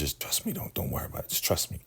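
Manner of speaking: hushed secret whisper. Retold or imitated. River quietly telling Peter to trust him, whispered. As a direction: breathe it so no one else hears.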